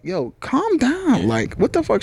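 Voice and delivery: high, slow, drawn-out yo calm down; startled calm down. Retold or imitated. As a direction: stretch it out, shocked and high. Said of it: "I'd be like".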